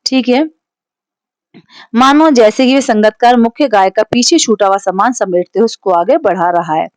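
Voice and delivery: ordinary talk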